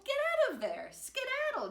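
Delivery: high, loud scolding call; mother scolding. A narrator's reading. Mummy calls her piggy out of the mud, loud and high. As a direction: shoo her out with a shrill loud order. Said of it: "See her mummy".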